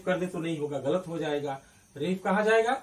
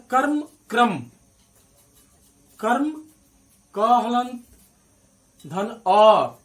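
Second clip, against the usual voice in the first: कर्म and क्रम set side by side, higher and louder; emphatic teacher contrast; contrast drill; 5 semitones higher, 9 dB louder, slower than usual. Shown as a demonstration. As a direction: make students hear कर्म against क्रम. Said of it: "जब हम कर्म देखें".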